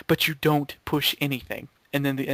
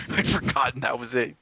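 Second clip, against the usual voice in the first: ordinary talk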